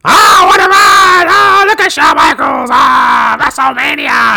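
very high, slow, strained scream; screaming announcer. Retold or imitated. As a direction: screech it like a manic announcer. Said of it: "this stuff he would do in the nineties".

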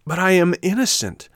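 high, loud puppet objection; pleading protest. A narrator's reading. Puppet insists to soldiers he is innocent, pleading.

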